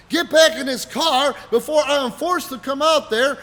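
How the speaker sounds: loud, high, formal ultimatum; imitated threatening friend. Ron ordering him back into the car, loud mock threat.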